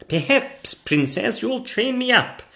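high-pitched polite request; hopeful knight. Acted. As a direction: ask the princess as a hopeful knight. Knight asks to be trained.

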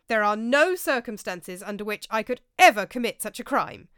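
loud, pitch rising high on the devil; indignant protest. A narrator's reading. Roger lecturing the table, loud and indignant. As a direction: hammer home his righteousness.

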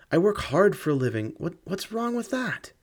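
high, trembling plea, faltering; plead, voice cracking high; desperate plea. Acted.

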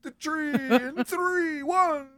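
big booming Santa countdown; Santa announcer voice. Retold or imitated. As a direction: hype it up like Santa at a show. Santa voice for tree lighting.

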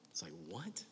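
baffled whisper; whispered aside. Real, not acted. Preacher puzzled by the dove line, whispered. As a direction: breathe it like a confused aside.

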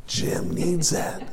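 slow, smug whispered aside; a slow, self-satisfied whisper about Jim. Retold or imitated. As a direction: hiss it out slowly, pleased it hits someone else.

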